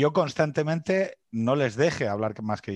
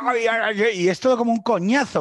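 mock lament; 9 semitones higher, 5 dB louder than usual. Mock lament, high pitched.

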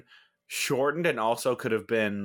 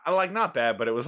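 ordinary delivery

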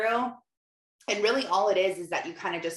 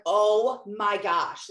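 drawn-out "Oh my gosh" at half speed, louder; stretched mock dismay; exasperated oh my gosh; 4 semitones higher, 4 dB louder, much slower than usual. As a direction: groan it out in disbelief. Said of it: "And I'm like".

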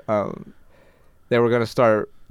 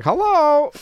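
Hello repeated much higher and louder, toy parrot squeak; squeaky parrot; 6 dB louder than usual. Retold or imitated. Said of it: "in a squeaky voice".

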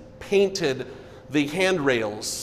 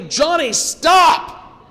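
urgent high shout of stop; sharp yell; 12 semitones higher, 7 dB louder, much slower than usual. Retold or imitated. Missionary stopping a child on wet paint, sharp shout. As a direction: yell it to halt him at once. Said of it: "she yelled quickly".

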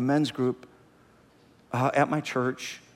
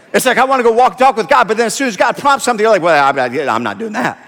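loud, high, driving sermon delivery; full-force preaching; 7 semitones higher, 12 dB louder, faster than usual. Real, not acted. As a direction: preach it loudly to the whole room.

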